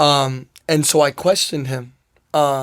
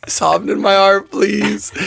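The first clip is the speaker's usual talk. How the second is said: slow, higher-pitched mock plea; sarcastic prayer; 5 semitones higher, 3 dB louder, slower than usual. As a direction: beg God half-seriously, pitch climbing on please. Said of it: "like, kinda sarcastic".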